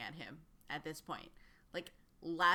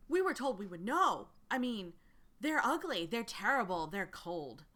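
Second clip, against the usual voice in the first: eager recruiter voice; 3 semitones higher, 5 dB louder than usual. Acted. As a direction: lean in like sharing a secret. Recruiter lists the threat.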